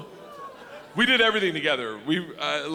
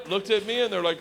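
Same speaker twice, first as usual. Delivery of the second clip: ordinary talk, nothing marked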